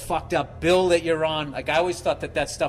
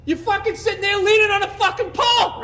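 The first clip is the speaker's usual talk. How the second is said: much higher, louder, exasperated chewing-out; angry rant; 7 dB louder than usual. Retold or imitated. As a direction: bawl him out like a furious bandleader. Frankie chewing him out backstage.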